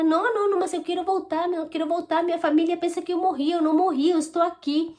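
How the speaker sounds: high-pitched insistent protest; retold pleading. Woman retelling her argument with the voices, pleading.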